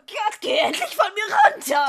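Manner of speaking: high yelled plea to get off; angry shout. Acted. Hermine shouting at the spider on her, angry shout. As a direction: shout it, desperate to shake her off.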